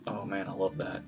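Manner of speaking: ordinary talk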